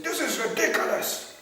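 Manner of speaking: high and loud exasperation; exasperated policeman, hammed up; mock outraged cop. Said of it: "And he said".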